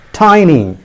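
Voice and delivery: "tiny" as TY-nee, falling; correct pronunciation. Shown as a demonstration. Teacher telling learners the correct form, "tiny" as TY-nee. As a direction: say "tiny" with an eye vowel. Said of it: "It is pronounced as".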